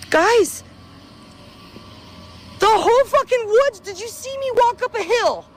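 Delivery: high, panicked pitch, rising alarm; frantic disbelief, voice jumping up; lost vlogger panicking to viewers, high and frantic; real panic. Real, not acted. Lost, the woods have changed.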